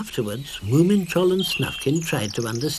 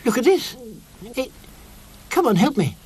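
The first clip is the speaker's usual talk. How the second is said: high, squeaky voice heaving with effort; straining squeaky Sniff; 11 semitones higher, slower than usual. A narrator's reading. Sniff urges the others to heave, high and straining. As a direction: strain to heave the heavy hat.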